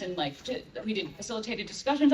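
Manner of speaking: ordinary delivery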